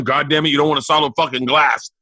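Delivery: low, grave cursing; dad's voice. Retold or imitated. Dad warning his kids about glass, imitated low voice. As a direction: drop the pitch and imitate a dad's stern warning. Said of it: "and he was dealing".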